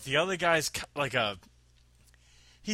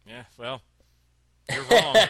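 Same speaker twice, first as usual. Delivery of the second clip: flat lead-in, then a much louder you're wrong; blunt retort; 11 dB louder than usual. Retold or imitated. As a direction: shut her down, punch the last words out. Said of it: "I'm like".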